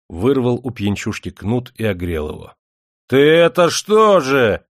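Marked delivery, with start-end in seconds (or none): 3.1-4.7 slow, high, loud roar. Acted. Ermilka roars at the old man who whipped him. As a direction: bellow in outrage. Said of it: "взревел Ермилка".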